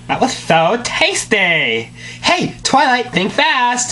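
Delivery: high, bubbly pony voice; squeaky cartoon pony; Pinkie Pie voice. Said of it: "reading all dialogue".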